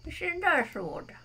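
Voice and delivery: talking around a pen; words through a pen held in the mouth. Real, not acted. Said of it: "You had something in your mouth".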